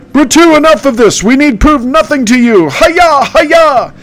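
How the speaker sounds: squeaky hero demanding an end; Candace voice. Acted. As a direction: hero shouting at the ghost king, high. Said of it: "that's my impression".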